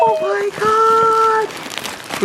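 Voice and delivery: drawn-out high slow oh my God; real excitement stretched long; thrilled gasp. Real, not acted. Opening the package, thrilled.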